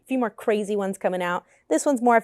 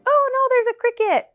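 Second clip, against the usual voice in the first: sudden high alarmed yelp; startled by a cricket, pitch jumps up; oh no, real alarm; 12 semitones higher than usual. Real, not acted.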